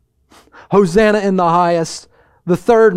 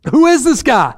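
puzzled crowd in uproar; 5 semitones higher than usual. Acted. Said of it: "there's an uproar in the city".

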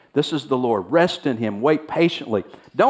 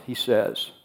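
ordinary talk, nothing marked

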